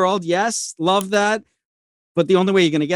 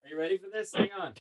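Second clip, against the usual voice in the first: turned away from mic; 4 semitones higher, 6 dB quieter, much faster than usual. Real, not acted. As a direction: voice drifting off mic. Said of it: "You're off mic, man".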